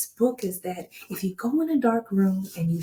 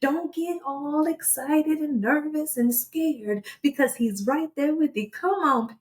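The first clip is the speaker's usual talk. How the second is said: preaching; 5 semitones higher than usual. Real, not acted. Friend preaching encouragement.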